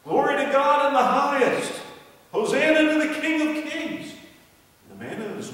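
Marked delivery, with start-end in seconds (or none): 0.0-4.1 joyful shouting crowd. Acted. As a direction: hail the king from the roadside. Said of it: "They heard people shouting and yelling".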